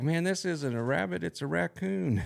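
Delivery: high-pitched mock holler; mock yelling back. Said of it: "they just yelled back".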